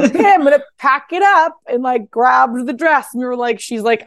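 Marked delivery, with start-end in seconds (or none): 0.0-1.6 high, bright mock-determined voice; imitating Kim. Kim announcing she'll pack the order, high and eager. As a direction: do Kim taking charge, pitched up.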